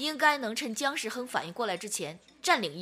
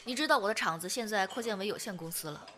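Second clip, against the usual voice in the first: ordinary delivery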